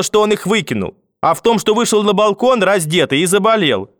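high-pitched, heated bickering; exasperated grandmother and grandfather bickering. A narrator's reading. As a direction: argue with pitch climbing to a peak.

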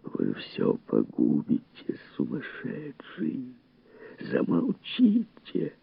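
slow, strained whisper in the ear; hushed, drawn-out warning; Faber. A narrator's reading. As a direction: scold urgently under your breath. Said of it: "Голос Фабера ввинчивался Монтегу".

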